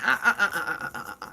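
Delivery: stuttering laugh. Real, not acted. Laughing at herself.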